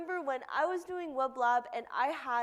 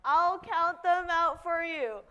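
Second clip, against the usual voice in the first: announcer call; 5 semitones higher, 7 dB louder, slower than usual. Real, not acted. Announcing contest start.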